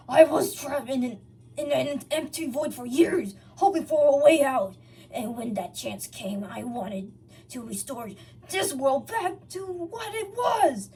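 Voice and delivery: Sonic explaining. A narrator's reading. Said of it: "he continued".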